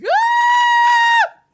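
sudden high shriek; startled scream. Retold or imitated. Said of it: "And I was just like".

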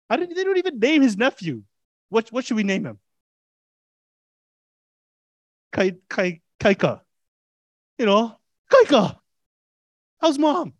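high, bright surprised greeting as Paul; Paul greeting nephew. Retold or imitated. Preacher voicing Paul as he greets his nephew, high and surprised.

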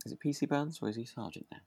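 normal talking voice

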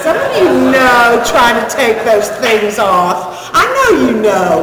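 laughing. Real, not acted. Teasing the audience, laughing.

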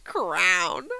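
crayon drawled as Crown; backwoods Crown. Shown as a demonstration. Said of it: "backwoods shit".

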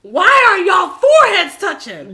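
high, loud comic scandalised shout; loud outrage. Real, not acted. Mock outrage at closeness.

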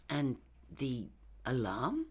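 soft, slow, hesitant query; a little nervous. A narrator's reading. Lindy questions the killer about the alarm, a little nervously. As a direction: ask haltingly and softly, slightly uneasy. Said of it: "a little more nervously".

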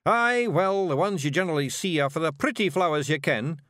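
high Scots bluff, loud at first; desperately inventing. A narrator's reading. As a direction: improvise an excuse as a cornered Feegle. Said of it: "inventing desperately".

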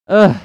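loud, high-pitched disgusted ugh; exasperated groan. Real, not acted. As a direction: let out a fed-up groan. Exasperated at stupid customer.

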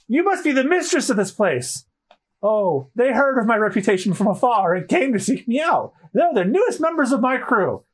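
high, grand character voice; high-voiced show-off; boastful captain. Voices boastful captain meeting mistress.

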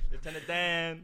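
Gump impression. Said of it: "Forrest Gump".

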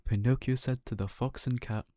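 plain reading voice

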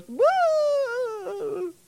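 high wailing fake boo-hoo; mock sob. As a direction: blubber like a big baby. Ahab started crying, mock sob.

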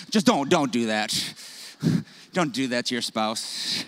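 ordinary talk, nothing marked

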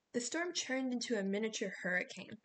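usual speaking voice

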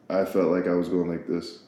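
ordinary talk

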